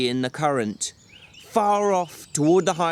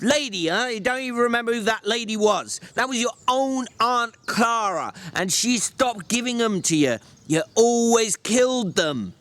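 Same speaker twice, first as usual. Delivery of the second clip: scoffing; 8 semitones higher than usual. Acted. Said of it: "George scoffed".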